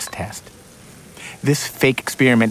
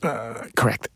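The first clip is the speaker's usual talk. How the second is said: sheepish quiet; 6 dB quieter than usual. Acted. Stumped admission.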